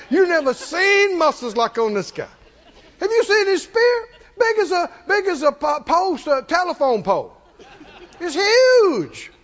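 wide-eyed soldiers hyping up the giant; gossiping fear. Retold or imitated. A mild mock soldier spreading fear about the giant. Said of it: "that whole bunch had talked like that".

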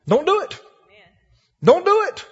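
high, loud, pleading command; urgent warning. Real, not acted. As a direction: warn them twice, climbing.

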